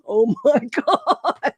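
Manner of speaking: slow, laughing "oh my God"; mock-stunned, laughing through the words. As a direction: imitate an eavesdropper who can't believe it. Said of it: "the person who was listening in ... is going".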